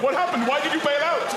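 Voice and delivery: high-pitched indignant demand; exasperated high questions; mock protest. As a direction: wail at someone who let you down. Acted protest at unanswered texter.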